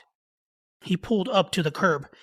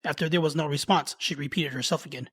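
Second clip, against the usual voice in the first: usual speaking voice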